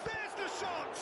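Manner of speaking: high, urgent commentator's cry; excited call. Real, not acted. Commentator breaks off the chat for a shot, excited cry. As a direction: call the shot the instant it happens.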